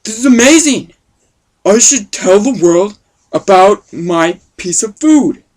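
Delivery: loud, thrilled discovery; excited. Acted. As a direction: shout it out like you found treasure. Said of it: "he's like".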